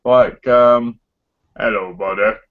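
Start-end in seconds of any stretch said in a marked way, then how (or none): 1.6-2.4 mock American accent. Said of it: "talking American".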